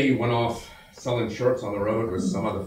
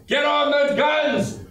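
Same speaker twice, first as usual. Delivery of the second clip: shouted order; 5 dB louder, slower than usual. Acted. Repeated battlefield order.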